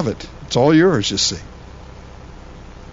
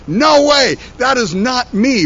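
higher, louder flat-out no; emphatic refusal; 7 semitones higher, 3 dB louder, slower than usual. Retold or imitated. His younger self flatly refusing to become a pastor, loud. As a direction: reject it outright.

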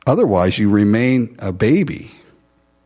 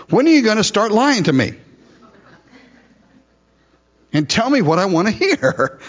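higher whiny exasperated king; mock exasperated king; 10 semitones higher than usual. Preacher paraphrasing king Ahab, higher mock whine. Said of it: "you hear what he's saying".

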